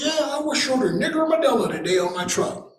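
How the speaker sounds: high, sly, pointed repeat of the jab; driver's taunt again. Retold or imitated. Driver repeating the jab for his buddy, high and pointed.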